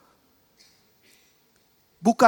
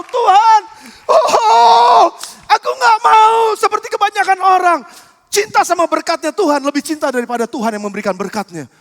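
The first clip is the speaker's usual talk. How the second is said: remorseful outcry; 6 dB louder than usual. Acted. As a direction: cry out in repentance, then calm down. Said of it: "Setelah Petrus nyesel".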